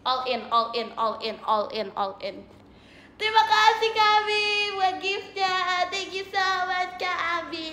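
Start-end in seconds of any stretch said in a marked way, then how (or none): 3.2-7.7 sing-song thanks. Real, not acted. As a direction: sing out your thanks to a viewer. Thanking a gift sender. (ucapan terima kasih bernada tinggi mendayu)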